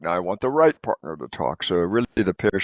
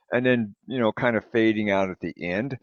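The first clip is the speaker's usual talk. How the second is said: usual speaking voice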